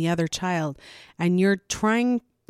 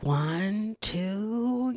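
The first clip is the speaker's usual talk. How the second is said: counting up slowly, rising on two; counting to three; 3 semitones higher than usual. Shown as a demonstration. Mom counting to three for a misbehaving child, rising. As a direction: count it out to build the warning. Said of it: "you're like".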